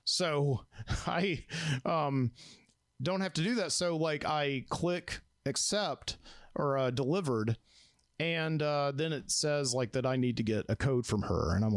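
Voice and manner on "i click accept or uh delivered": ordinary talk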